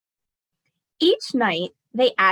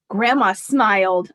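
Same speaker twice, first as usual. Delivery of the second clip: ordinary delivery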